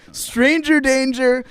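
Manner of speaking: high, drawn-out warning cry; hammed-up kids' alarm at a suspicious grown-up; mock alarm. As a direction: sing out a slow, high-pitched mock alarm. Teens mock alarm at lying adult.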